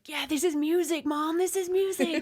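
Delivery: put-on high, emphatic teenage insisting; mock teen insistence. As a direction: act out younger self defending the music. Teen self quoted to mom.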